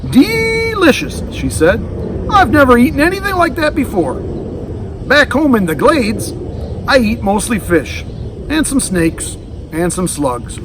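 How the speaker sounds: high happy creature voice; delighted alligator. Acted. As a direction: relish the meal like a contented alligator.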